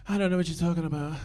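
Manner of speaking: fast, hushed innocent denial. Retold or imitated. Imitating [name]'s denial.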